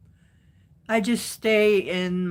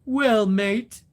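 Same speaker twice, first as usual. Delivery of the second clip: louder, higher, tentative Australian accent; attempted Aussie accent; 4 semitones higher, 6 dB louder than usual. Shown as a demonstration. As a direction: have a rough go at sounding Australian. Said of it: "I don't know if I can do Aussie".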